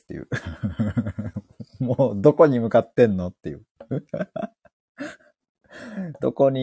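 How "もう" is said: usual speaking voice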